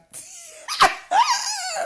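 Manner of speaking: a burst of laughter far above the voice; loud, high jeering laughter; high mocking laugh. Real, not acted. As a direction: cackle loudly and high in mockery. Mocking rival's accident.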